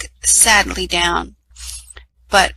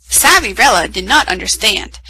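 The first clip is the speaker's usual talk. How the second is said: usual speaking voice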